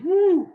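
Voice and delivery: a high, loud whoo of relief; relieved whoo. Retold or imitated. Relieved after lunch with the parents, high loud whoo. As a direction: let the breath out in a high whoop. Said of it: "And I'm thinking".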